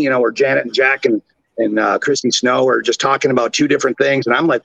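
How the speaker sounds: normal talking voice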